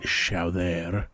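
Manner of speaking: "chowder" said as French "show-DAIR"; thick French kitchen-worker accent on "chowder". Retold or imitated. Explaining the French cook's chowder to viewers, thick French accent. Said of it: "he has a very thick accent".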